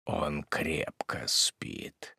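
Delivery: slow, soft sinister whisper; ominous whisper. A narrator's reading. Hermit hints to the widow her son is dead, whispered. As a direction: breathe it out like a threat.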